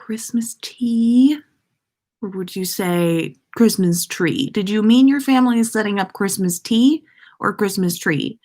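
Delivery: ordinary talk, nothing marked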